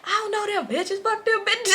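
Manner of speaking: high-pitched imitation of denial; mock a man defensively pleading innocence; protesting boyfriend. Voicing man protesting to girlfriend.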